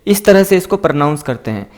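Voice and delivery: ordinary delivery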